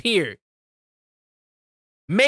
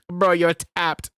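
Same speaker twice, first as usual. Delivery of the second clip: mock British accent; 2 semitones lower than usual. Trying out new slang again.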